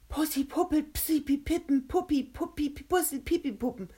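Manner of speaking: high-pitched stumbling character voice; flustered garbled attempt. A narrator's reading. Kovac obeys the order to hurry, high and hopelessly tangled. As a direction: trip over the repeated p sounds.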